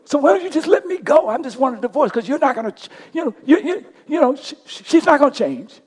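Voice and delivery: high, loud anguished cry at God; retold scream at God. Man alone in his hotel shower, yelling at God about his marriage, high and loud. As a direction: cry out to God, pleading and frustrated. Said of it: "screaming and screaming at God".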